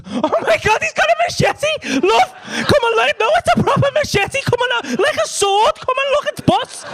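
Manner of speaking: very high, thrilled squeal; gleeful excitement. Retold or imitated. Husband calling his love to see the machete, giddy excitement. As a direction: call them over to see something amazing. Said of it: "My natural reaction to that was".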